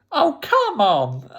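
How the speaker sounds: high, drawn-out mock protest; playfully outraged groan of a complaint; exasperated. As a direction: wail it in comic disbelief. Retelling an exasperated quiz reaction.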